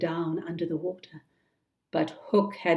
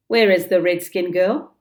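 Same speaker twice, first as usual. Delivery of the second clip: loud, sharp question; sudden demand; 9 dB louder than usual. Acted. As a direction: demand it like an order. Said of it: "suddenly Hook demanded".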